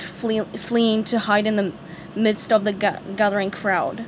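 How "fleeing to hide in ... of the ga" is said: usual speaking voice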